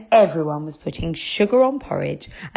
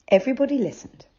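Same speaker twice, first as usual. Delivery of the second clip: plain reading voice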